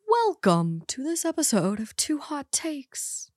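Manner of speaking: slow, soft radio host intro. Acted. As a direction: purr it like a late-night DJ. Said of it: "put on your radio voice for that".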